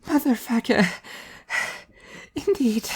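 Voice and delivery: high-voiced Emilia raising a toast, mock grand; a high, mock-solemn toast with a sigh; high young woman proclaiming like a toast. A narrator's reading. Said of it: "and proclaimed".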